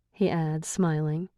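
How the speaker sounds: plain reading voice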